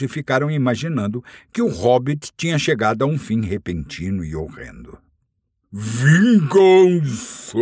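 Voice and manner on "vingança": slow, drawn-out, loud dragon snort. Acted. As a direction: huff it out like an enraged dragon. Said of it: "bufou o dragão". (dragão bufando de desprezo)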